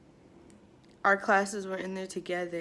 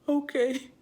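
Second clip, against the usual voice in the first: gasp, then high, louder okay; mock startled compliance; 6 semitones higher, 5 dB louder than usual. Girl answering the scolding pastor, mock startled compliance. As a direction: retell it with a startled gasp and raised pitch.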